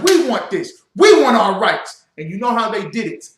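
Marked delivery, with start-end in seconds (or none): 0.0-2.1 shouted, high and loud; colonists' demand. Retold or imitated. Preacher shouting the colonists' demand, loud. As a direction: hurl the demand like a rebel. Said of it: "and said".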